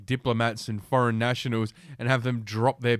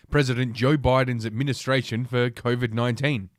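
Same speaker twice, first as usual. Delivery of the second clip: normal talking voice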